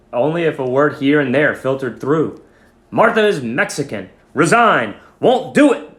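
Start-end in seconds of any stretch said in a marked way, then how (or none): 2.9-5.9 loud, high, snapped fragments; angry. Acted. Teacher Mister Schmidt refusing his colleague, angry raised voice. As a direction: bite off each angry phrase. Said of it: "he sounded very angry".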